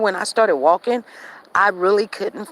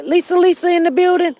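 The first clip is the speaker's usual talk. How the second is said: excited call-out; 11 semitones higher, 6 dB louder than usual. Real, not acted. Greeting viewers by name, calling.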